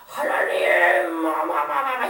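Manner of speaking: drawn-out strained cry to God, then stammering; mock cursing. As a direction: act out sinners railing at heaven in stuttered bursts. Acting out sinners cursing at heaven.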